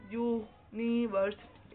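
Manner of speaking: University stretched slowly, syllable by syllable; slow, drawn-out University. Shown as a demonstration. Teacher to pupils, slow drawn-out demo word. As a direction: spell out university slowly for the class.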